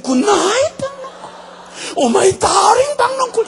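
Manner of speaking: hammed-up high, loud lovestruck cry; gushing adoration. Jonah saying good night to his darling vine, gushing. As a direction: gush over a beloved darling. Preacher voices doting Jonah greeting plant.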